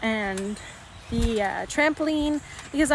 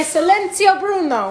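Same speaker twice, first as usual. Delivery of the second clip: Silenzio rolled out louder and higher, Italian style; Silenzio, Bruno in a mock Italian voice; Italian command; 5 semitones higher, 5 dB louder than usual. Shown as a demonstration. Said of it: "Bobby, say".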